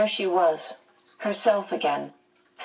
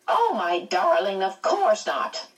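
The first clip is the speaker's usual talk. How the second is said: ordinary delivery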